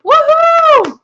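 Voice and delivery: high, loud woohoo; excited cheer. Real, not acted. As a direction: whoop it up with joy. Celebrating fifty-eight group members.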